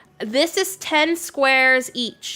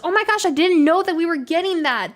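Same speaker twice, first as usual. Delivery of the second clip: higher, louder, delighted surprise; real surprise; 6 semitones higher, 4 dB louder than usual. Real, not acted. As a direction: caught off guard by good news. Surprised by news of update.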